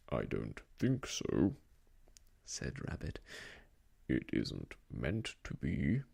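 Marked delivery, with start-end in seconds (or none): 0.1-1.6 much lower put-on voice; disguised voice. Rabbit pretending not to be himself, low disguise. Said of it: "different sort of voice this time". 4.1-6.0 low, slow put-on voice; disguised voice. Rabbit insisting it isn't his voice, low disguise. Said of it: "different sort of voice this time".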